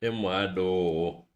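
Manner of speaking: put-on slow, drawn-out [name] style accent. Singing along to [name], slow put-on [name] style accent. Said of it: "I wish I talked like this full time".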